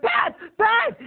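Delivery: high, loud cry of her name; desperate call. Retold or imitated. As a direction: call after her in a panic. Calling after her.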